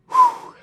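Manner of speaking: shaky breath pushed out through tears; shaky exhale. Real, not acted. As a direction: let out a trembling whew. Fighting back tears.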